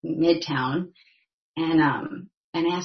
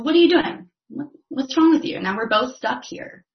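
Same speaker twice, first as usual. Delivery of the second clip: high, loud burst that settles down; acted-out alarmed scolding; exasperated disbelief; 8 semitones higher, 6 dB louder than usual. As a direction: berate the would-be helper, pitched way up at first. Man berates helper who jumped in.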